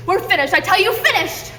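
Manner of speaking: high, hammed-up angry accusations; mock emotional outburst. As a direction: overact the betrayal. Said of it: "requires an emotional outburst".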